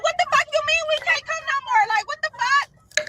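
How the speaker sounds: outraged. Retold or imitated. Demanding why she can't come, high furious outrage. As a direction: fire it back high and furious.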